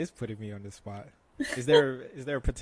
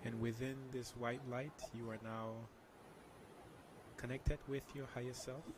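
soft spa whisper; 16 dB quieter than usual. Shown as a demonstration. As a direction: guide them into calm, very softly. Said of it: "can you lead us through a meditation".

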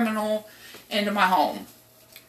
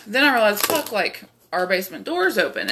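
ordinary delivery

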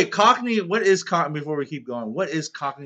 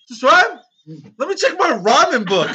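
high, loud mock incredulity; mocking disbelief; 11 semitones higher, 8 dB louder than usual. Teasing a teammate over the rhyme, mocking disbelief. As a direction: scoff loudly, pitched way up.

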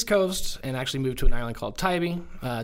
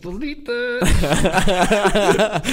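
slow, loud, laughing drawl; laughing plea; 6 semitones higher, 9 dB louder, much slower than usual. Retold or imitated. Asking her to delete the voicemail, laughing. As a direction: beg through laughter.

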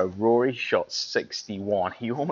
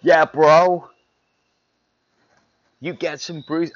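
loud, higher bro cheer; 6 semitones higher, 9 dB louder, slower than usual. Retold or imitated. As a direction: hype it up like a frat bro. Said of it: "you'd all be like".

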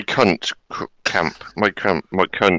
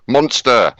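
higher, louder announcing shout; shouted call-out; 4 semitones higher, 5 dB louder than usual. Shown as a demonstration. Host showing viewers what to shout, in a raised call-out. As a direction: call it out like a game cry. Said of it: "we've gotta shout out".

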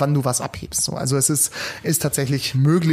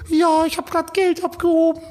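much higher, slow, tearful-sounding woman; teary woman; 13 semitones higher, slower than usual. Retold or imitated. Retelling the woman's answer, high slow tearful voice. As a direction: squeak it out like the shaken owner of the money. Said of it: "Und sie so".

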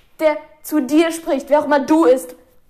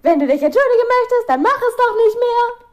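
raised, louder mock-indignant complaint; exasperated retort; 8 semitones higher, 5 dB louder than usual. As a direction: fire back at the teacher in your head, high and loud. Acted inner retort to teacher.

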